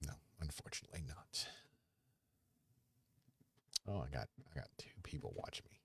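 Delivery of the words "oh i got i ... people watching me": fast excited whisper; whispered aside. Real, not acted. Streamer whispers that two viewers are watching. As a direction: breathe it out quick and secret.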